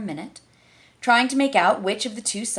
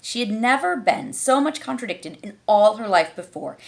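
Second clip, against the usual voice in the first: usual speaking voice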